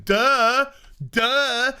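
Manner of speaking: dopey duh-duh noises, hammed up; mock dumb duh. Mocking the crew as idiots, dopey grunting. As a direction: play it like a bunch of idiots grunting.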